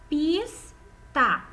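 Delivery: "Pista" in two beats, pis, pause, ta; syllable by syllable. Shown as a demonstration. Teacher counting mouth openings for pupils, "Pista" split in two. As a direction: break "Pista" after the S, one mouth opening each. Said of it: "Quantas vezes eu abri minha boca?".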